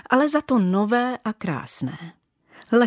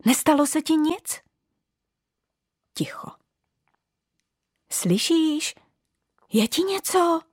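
high worried calls; anxious calling; 8 semitones higher than usual. Acted. Little bauble calling under the bookcase, worried. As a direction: call out anxiously into the dark.

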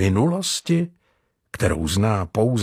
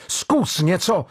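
roaring at Jordan; 9 semitones higher than usual. A narrator's reading. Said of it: "zařval Mario".

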